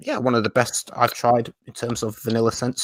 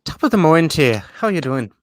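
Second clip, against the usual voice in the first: higher-pitched, hammed-up Irish greeting; mock Irish accent; 5 semitones higher, 3 dB louder than usual. Co-host teasing a viewer who objects to his accents, mock Irish. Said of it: "is that an Irish accent".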